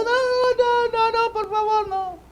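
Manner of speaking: very high, pleading falsetto cries; imitating a begging martyr. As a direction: beg for mercy in a shrill-free high voice. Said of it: "iban gritando". (imitando a un mártir que suplica con voz muy aguda)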